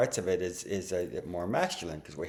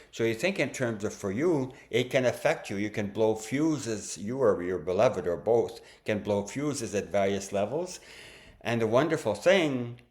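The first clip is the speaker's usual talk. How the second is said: ordinary talk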